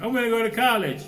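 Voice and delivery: high, loud whining want; mock a spoiled kid's demand; whiny youth. Voicing a whiny young person.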